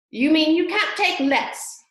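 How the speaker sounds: loud, high, slow Hatter. Acted. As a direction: correct her smugly and loud.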